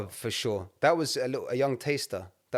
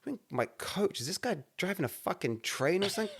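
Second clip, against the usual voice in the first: higher, softer bemused question; incredulous mockery; 6 semitones higher, 6 dB quieter than usual. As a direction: pitch it up as if it's absurd. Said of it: "And I was like".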